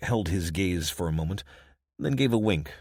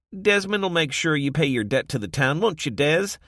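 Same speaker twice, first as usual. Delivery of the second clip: high, loud mock-deferential ribbing; teasing barkeep; 9 semitones higher, 6 dB louder than usual. Josie mocking Desmond's new mayor status, high and playful. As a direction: rib him about his new title.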